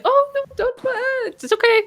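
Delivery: very high, anxious soothing voice; flustered reassurance. Acted. Game master as Viola soothing a panicking captive, high and flustered. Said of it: "Viola's like".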